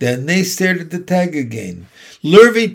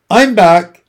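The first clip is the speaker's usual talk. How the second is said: loud, higher, husky announcement; 5 semitones higher, 10 dB louder than usual. A narrator's reading. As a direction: announce your return with a touch of huskiness. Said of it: "he said in a husky voice".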